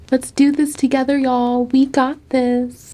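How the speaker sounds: rally them in a put-on upbeat voice; mock pep talk. Student rallying viewers to study, mock pep talk.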